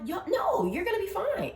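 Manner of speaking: high, eager comfort; hyped reassurance. Retold or imitated. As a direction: cheer a friend up. Reassuring a friend.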